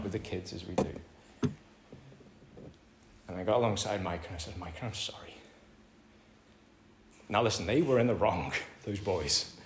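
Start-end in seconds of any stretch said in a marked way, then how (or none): 4.6-5.3 gentle apology. Retold or imitated. Father apologising to his son.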